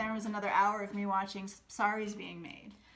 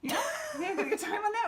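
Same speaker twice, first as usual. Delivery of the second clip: high delighted agreement; 13 semitones higher, 3 dB louder than usual. Real, not acted. Fond agreement about the project.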